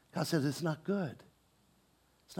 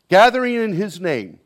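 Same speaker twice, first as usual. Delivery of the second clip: louder, higher, hammered home; emphatic; 8 semitones higher, 14 dB louder than usual. Shown as a demonstration. Preacher hammering the key phrase home, emphatic and loud. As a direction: drive the phrase into them. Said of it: "I'm emphasizing it".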